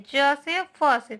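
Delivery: ज and फ said slowly with the dotted sound; teacher slowly sounding the dotted letters; slow sound demo. Shown as a demonstration. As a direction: stretch out ज से फ से for learners. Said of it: "इनका उच्चारण करना सीखिए".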